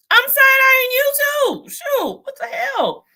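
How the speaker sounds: high, loud cry of disbelief; exasperated shout. Real, not acted. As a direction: throw it at them, fed up and loud. Calling out Trump voters.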